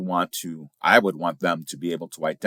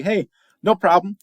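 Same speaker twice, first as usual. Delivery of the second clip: cheery reply; 13 semitones higher, 5 dB louder than usual. Retold or imitated. Cheerful quoted phone reply.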